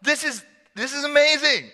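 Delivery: high, gushing awe; comic overeager amazement; awkward Peter. Acted. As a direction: blurt it out like a starstruck disciple. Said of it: "this is what he says".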